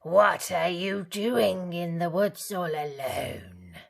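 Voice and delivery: loud sly wolf; a hungry wolf fishing for information. A narrator's reading.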